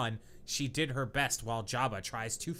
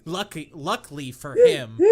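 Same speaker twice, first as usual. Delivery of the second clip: bursting loud; 6 semitones higher, 10 dB louder than usual. Real, not acted. Reading aloud, cracking up.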